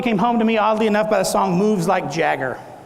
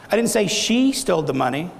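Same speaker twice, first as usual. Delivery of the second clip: "she" punched, the rest falling away; stress on SHE; 3 semitones lower than usual. Shown as a demonstration. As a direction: hit the word "she", as if someone else stole it. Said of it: "say that several different ways".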